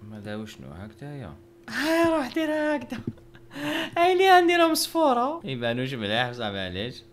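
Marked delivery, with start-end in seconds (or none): 1.7-5.4 much higher, squeaky mock voice. Mocking the drawing in a squeaky voice while painting. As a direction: squeak it like a cartoon.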